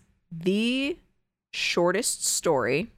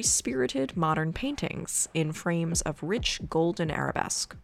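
hushed creepy legend telling; 2 semitones lower, 3 dB quieter than usual. Acted. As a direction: read it like a ghost story by candlelight. Reads Poe tale over music.

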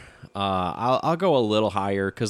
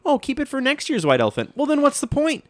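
high-pitched, exasperated imitation; mock exchange; 13 semitones higher, 3 dB louder than usual. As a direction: act it out way up high, incredulous. Mocks gift-giver, then argues back.

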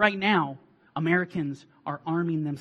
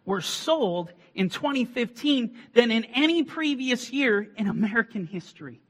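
loud, high-pitched preacher's cry; fiery preaching; 6 semitones higher, 6 dB louder than usual. Acted. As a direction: preach it out with full force. Preaching at full force.

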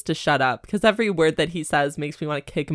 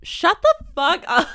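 mock snap; 11 semitones higher, 5 dB louder than usual. Mock angry retort.

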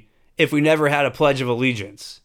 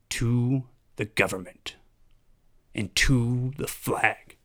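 low, measured mock-official address; hushed, creepy-ceremonial leader, slowing phrase by phrase; solemn politician; 6 semitones lower, 4 dB quieter than usual. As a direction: instruct the nation in pledge ritual, deliberately and softly. Imagined politician instructs the public.

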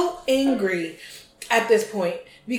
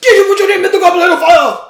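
fast, much higher, very loud furious shout; Gambon's charging Dumbledore; 7 semitones higher, 13 dB louder, faster than usual. Retold or imitated. Co-host mimics Gambon running at Harry, fast shouted accusation. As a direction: yell it while charging at him. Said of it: "here comes Michael Gambon just running at him".

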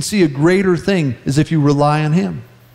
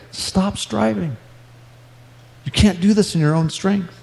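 tender correction; 4 dB quieter than usual. Retold or imitated. God gently correcting the pastor, tender and soft. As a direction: ease the words out quietly and kindly. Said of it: "correcting me and guiding me just tenderly".

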